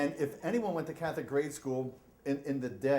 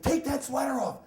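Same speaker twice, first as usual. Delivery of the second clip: higher, firm command; stern repeated order; 8 semitones higher, 3 dB louder than usual. Retold or imitated. Nun ordering boy again to take sweater off, higher and firm. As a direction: lay down the law like a nun. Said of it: "She's like".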